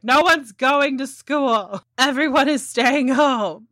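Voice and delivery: slow, high-pitched proclamation; mock dad decree. Dad to kids who would tell their friends, mock decree. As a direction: draw it out as an emphatic parental ruling. Dad's acted decree in story.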